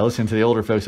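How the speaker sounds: ordinary talk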